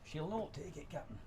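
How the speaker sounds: mock Scottish warning to the captain; Scottish accent. Warning the captain about the loco, put-on Scottish accent. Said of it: "That's my bestest Scottish accent".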